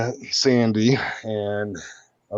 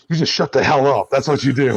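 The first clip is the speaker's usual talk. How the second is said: higher and louder snap at the hostage; gruff mock yell; 6 semitones higher, 6 dB louder than usual. Kidnapper yelling at captured deputy, higher and louder. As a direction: bark it at the kidnapped cop. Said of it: "I go".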